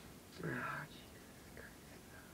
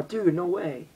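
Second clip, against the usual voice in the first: usual speaking voice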